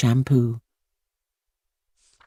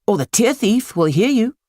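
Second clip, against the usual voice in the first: mother; 9 semitones higher than usual. Acted. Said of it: "said the boy's mother".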